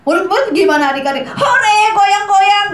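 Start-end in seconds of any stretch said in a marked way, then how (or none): 1.3-2.7 high, loud cheering; mock cheer. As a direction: cheer like kids on a bouncy ride. Acting disciples cheering on boat.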